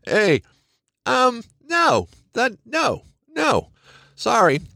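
playful refusal to go along; dismissive refusal. Retold or imitated. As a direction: brush off the narrative with a light high voice. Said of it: "where we're just like".

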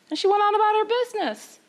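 usual speaking voice

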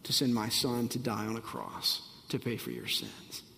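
soft, low words of God, fading to a whisper; gentle voice of God trailing into a whisper; God's tender voice. Acted. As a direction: speak as a loving God, very softly. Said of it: "that he says".

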